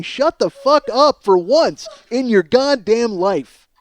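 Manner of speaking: ordinary talk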